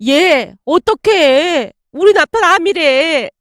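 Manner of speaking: high, slow, choked-up plea; a friend near tears breaking bad news; on the verge of tears. A narrator's reading. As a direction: friend on the verge of tears tells her husband has cancer. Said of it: "울먹이며 말을 꺼냈습니다".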